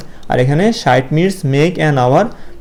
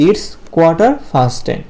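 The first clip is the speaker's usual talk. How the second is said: slow model phrase; much slower than usual. Shown as a demonstration. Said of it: "কি বলবো?".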